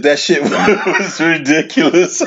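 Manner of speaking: reads it slow, laughing through it; can't get through the line without cracking up; slow, giggling read of a chat message; laughing while reading. Real, not acted.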